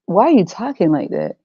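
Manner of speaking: ordinary talk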